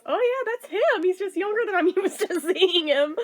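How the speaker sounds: high, pleased realization with a laugh; light up as it clicks; high and amused, finally recognizing him; delighted recognition. Retold or imitated. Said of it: "the more I stare at the face".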